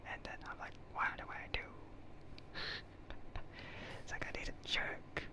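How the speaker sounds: usual speaking voice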